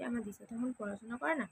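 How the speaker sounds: usual speaking voice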